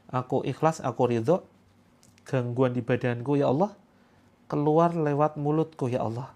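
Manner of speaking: usual speaking voice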